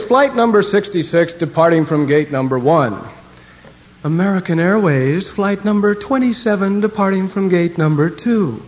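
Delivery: mock gate announcer, projected singsong calls; airport announcer. Announcing departures at the plane station, mock announcer voice. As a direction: call out flights like an airport announcer. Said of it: "listening to them announce the planes".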